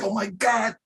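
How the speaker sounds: high, hammed-up outraged parent; hammed-up scandalised parent voice; mock shocked parent. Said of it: "some parents will probably be like".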